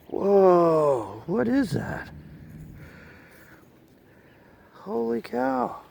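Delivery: awed high whoa, drawn out; amazed. Real, not acted. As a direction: marvel at the find, slowly. Amazed at a dug-up medal.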